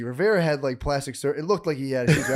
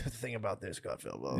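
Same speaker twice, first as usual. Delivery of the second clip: low, murmured talking-head voice; mumbling pundit impression; 8 semitones lower, 11 dB quieter than usual. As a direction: drone it out low and quiet. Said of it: "he'll roll on and be like".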